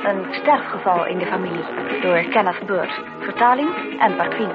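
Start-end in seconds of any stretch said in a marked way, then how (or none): none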